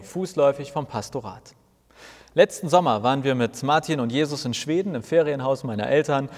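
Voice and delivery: usual speaking voice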